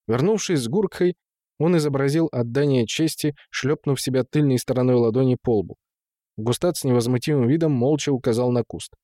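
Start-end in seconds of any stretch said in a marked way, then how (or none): none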